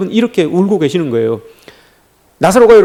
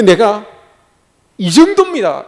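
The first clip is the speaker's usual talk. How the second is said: high, self-important boast; showing oneself off; 5 semitones higher than usual. Acted. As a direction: put yourself on display, pitch pushed well up. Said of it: "이렇게 드러내는데".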